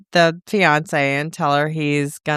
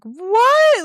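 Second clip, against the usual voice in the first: high, loud shocked squeak; shocked what; 5 dB louder than usual. Retold or imitated. Kane stunned that the woman rejects him, shocked squeak. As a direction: imitate his stunned disbelief. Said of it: "he's so shocked".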